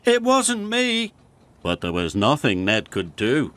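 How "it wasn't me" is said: high-pitched, pleading denial; protesting. A narrator's reading. Ned blamed for the slate, protesting. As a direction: deny it in high-voiced protest. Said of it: "protested Ned".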